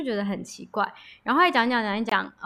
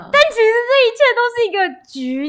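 excited reveal; 12 semitones higher, 7 dB louder than usual. Real, not acted.

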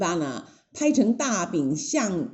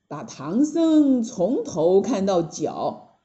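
usual speaking voice

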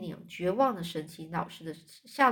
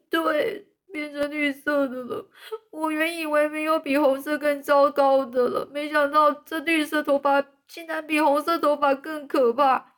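wailing, higher and louder with a cry; moaning; 7 semitones higher, 6 dB louder than usual. Acted. Anne wailing to Marilla about green hair. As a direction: groan it out in misery. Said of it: "安简直就像在呻吟那种".

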